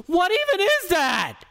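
high, far-off hammed-up scream of the segment title; over-the-top mock panic. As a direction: shriek it like a panicked voter. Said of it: "no reason to go screaming".

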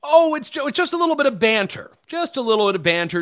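sarcastic mockery. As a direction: toss the excuse back with a scoff.

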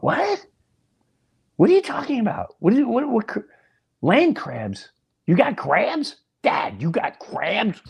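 high-pitched, stammering disbelief; incredulous questioning. Retold or imitated. Son baffled by Dad's land crabs, incredulous high voice.

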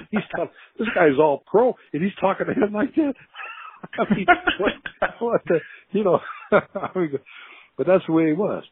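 high, disbelieving laughing rant; incredulous. Real, not acted. Old player recalling coach's insults, high and incredulous. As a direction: still amazed, voice jumping up high.

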